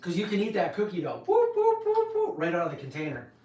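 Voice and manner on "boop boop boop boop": very high playful boops; boop sounds. Pretend. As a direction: chirp it like a cartoon effect. Playful sound effect while scooping.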